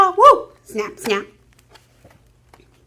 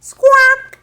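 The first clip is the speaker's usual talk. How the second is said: parrot squawk; 10 semitones higher, 5 dB louder than usual. Retold or imitated. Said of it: "Parrot's such a noisy bird".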